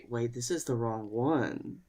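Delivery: ordinary talk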